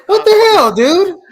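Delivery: high and loud, half-laughing defence; indignant protest. Real, not acted. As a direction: insist you had him all along. Co-host protests he has him.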